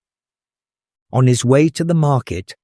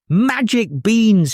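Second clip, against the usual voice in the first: higher, slow furious mother's cry; angry shout; 9 semitones higher, slower than usual. Acted. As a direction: shout at a foolish son. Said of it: "she shouted".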